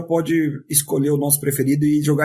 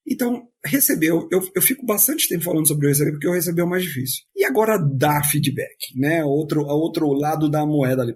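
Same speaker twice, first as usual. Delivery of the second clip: normal talking voice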